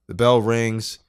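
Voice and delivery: usual speaking voice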